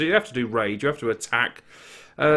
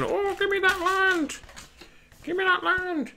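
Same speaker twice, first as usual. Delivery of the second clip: Irish accent impression; 5 dB quieter than usual. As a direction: mimic a friend's hammed-up Irish accent begging for the card. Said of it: "In his best Irish voice".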